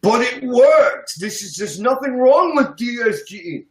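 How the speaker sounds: slow, high mock-earnest protest; high-pitched mock believer, slowed on works; mocking neo-Keynesian. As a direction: parody a true believer insisting it works. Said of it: "hold on to it with great religious faith say".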